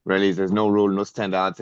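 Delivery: ordinary talk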